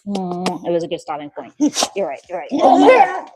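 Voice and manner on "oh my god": high, loud oh my God; shocked exclamation. Real, not acted. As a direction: blurt it out in disbelief. Dog's wild behavior.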